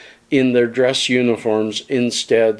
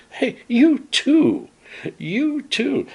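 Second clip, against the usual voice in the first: much higher, drawn-out mimicked plea; high-pitched mock retort; 13 semitones higher, slower than usual. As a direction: throw it up high, like calling someone out. Acting out Don Cherry's retort.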